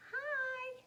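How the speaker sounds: very high squeaky little hi; tiny doll voice. Acted. Doll Niblet answering Petal, squeaky high. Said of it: "said Niblet".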